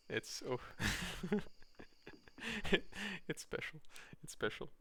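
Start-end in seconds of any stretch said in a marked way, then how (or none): 0.6-3.2 high burst of laughter; real amused laugh. Real, not acted. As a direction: break up laughing mid-story. Amused while telling a story.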